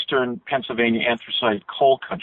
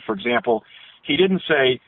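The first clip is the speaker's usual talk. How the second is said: ordinary delivery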